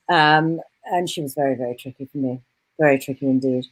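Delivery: cool, guarded senior doctor; Serena Campbell. Acted. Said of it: "while you get into character".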